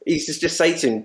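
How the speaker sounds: ordinary talk, nothing marked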